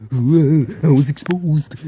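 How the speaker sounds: high, loud and slow, hammed-up dread; mock horror. Kid waking up uncovered, hamming up terror, high and loud. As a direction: wail it like a doomed kid in a horror film.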